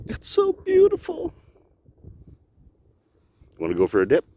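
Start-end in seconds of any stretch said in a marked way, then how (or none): 0.1-1.3 high sing-song. Pretend. Admiring the glistening water.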